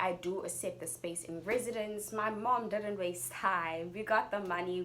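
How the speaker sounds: ordinary talk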